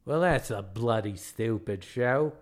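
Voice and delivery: dismissive put-on Australian accent; mean Australian guide. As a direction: scoff in a broad Australian accent. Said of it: "the tale of the meanest man in Australia".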